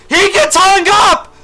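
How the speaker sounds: high, loud and slow outraged yell; real raging shout at his own army; furious shout. Real, not acted. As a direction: scream at the blundering unit. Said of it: "going through a Greek tragedy".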